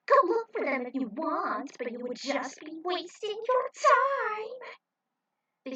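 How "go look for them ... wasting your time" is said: mocking taunt. Zoda mocking Tinsel.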